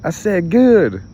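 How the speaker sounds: loud, pointed correction on good; loud insistence. Real, not acted. Correcting the song lyric, loudly insisting on good. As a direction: shout the word good to fix it.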